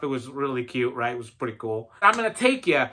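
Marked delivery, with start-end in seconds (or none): 2.0-2.9 higher-pitched put-on Southern accent; amateur Southern accent, pitched up. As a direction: imitate a Southern airline helper. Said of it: "talked to me in a Southern accent".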